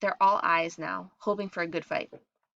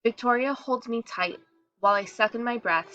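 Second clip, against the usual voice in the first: ordinary delivery, nothing marked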